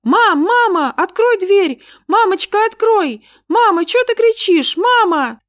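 put-on high, loud kids yelling over each other; frightened children shouting for their mother. A narrator's reading. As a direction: bang on the door calling mum. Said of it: "наперебой крича".

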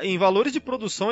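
ordinary delivery

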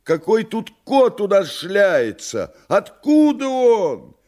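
high, loud panicked yell; frightened shout. Acted. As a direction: cry out in sudden fright. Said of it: "испуганно закричал".

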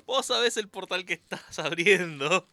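slow loud warning. Real, not acted. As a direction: drag out the warning. Repeats warning slower.

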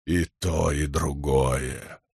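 low, slow, soft godlike voice; rumbling Stormfather. A narrator's reading. Storm god answers both, low and slow. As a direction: let it sink away like distant thunder. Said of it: "Голос загрохотал точно далёкий гром".

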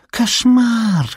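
very high, cutesy cry of dismay; exclaiming little creature. A narrator's reading. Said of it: "воскликнула Гермия". (писклявый возглас зверька)

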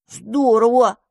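grudging, envious "Здорово"; mouse-foe envious of the dandelion's luck. Acted. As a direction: say "Здорово" with a pang of envy. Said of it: "позавидовал мышевраг".

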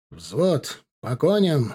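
usual speaking voice

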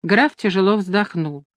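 ordinary delivery

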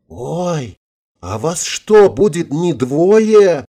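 high, drawn-out mock dismay; hammed-up timid question; feigned horror. A narrator's reading. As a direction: play up pretend fright, stretching the end. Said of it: "Чуть не задрожала от притворного ужаса".

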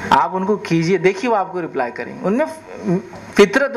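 ordinary talk, nothing marked

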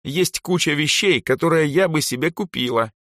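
put-on high-pitched girl character; girl's voice. A narrator's reading. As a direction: voice a girl talking about her wishes. Elsa's voice, listing wishes.

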